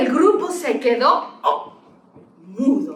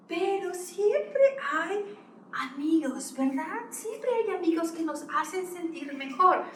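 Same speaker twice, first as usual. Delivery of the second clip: gentle; 5 semitones higher, 7 dB quieter than usual. A narrator's reading. Gentle comforting aside to child.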